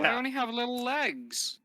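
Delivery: small squeaky voice; lightly voiced little creature; tiny character voice.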